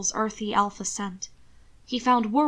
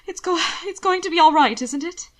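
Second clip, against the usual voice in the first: high, louder, breaking with a sob; voice cracking; 7 semitones higher, 5 dB louder than usual. Acted. Alana begs Hannibal for reassurance, voice cracking. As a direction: fight back tears as you ask. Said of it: "her voice cracking on a sob".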